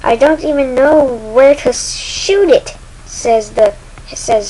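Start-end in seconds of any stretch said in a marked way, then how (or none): 0.0-2.8 higher, louder alarm; panicked. Acted. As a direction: call out helplessly mid-battle. Sasha panics during attack.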